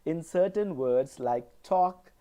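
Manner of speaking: normal talking voice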